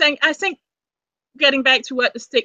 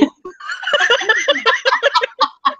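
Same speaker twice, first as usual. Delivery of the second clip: high laugh; 12 semitones higher than usual. Real, not acted. Laughing at name mix-up.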